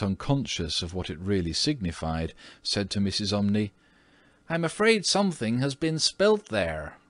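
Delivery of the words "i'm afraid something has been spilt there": lady's remark. Acted. Mrs. Otis notices a stain.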